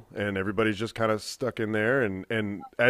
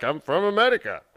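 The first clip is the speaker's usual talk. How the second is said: higher-pitched put-on accent with a tapped "America"; mock foreign accent; 6 semitones higher than usual. VRChat friend claiming his origin, in a mock foreign accent. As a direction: imitate a vague foreign accent. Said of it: "He starts talking with an accent".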